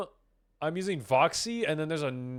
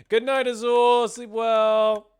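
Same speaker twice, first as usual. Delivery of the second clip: higher, louder, slow sweet goodnight; playful cutesy sign-off; sing-song goodnight; 8 semitones higher, 9 dB louder, much slower than usual. Pretend. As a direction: coo goodnight to a viewer. Saying goodnight to viewer.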